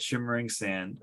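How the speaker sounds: ordinary delivery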